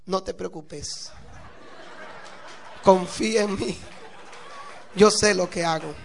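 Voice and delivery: grin through it, then let the last line ring out; smiling reassurance. Acted. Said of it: "la miró con una sonrisa".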